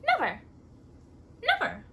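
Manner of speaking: loud, firm repeated never; emphatic never. Real, not acted. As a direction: slam the word down twice, rising. Emphatic answer to puppet story.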